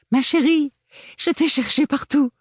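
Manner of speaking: very high, loud, gushing big sister. Acted. Agathe greeting her little sister, high and gushing. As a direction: sweep in all sugary.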